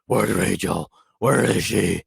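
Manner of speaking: raspy, growled Batman impression; rough, gravel-throated mock Batman demand. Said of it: "talks about how every Batman talks like".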